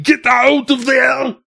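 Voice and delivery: drawn-out high shout; yelling. Retold or imitated. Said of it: "I'm yelling at Zum".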